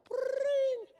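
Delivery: very high trilled phone ring; shrilling mock ringtone; imitated phone ring. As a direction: trill like an old telephone bell. Imitates the telephone ringing.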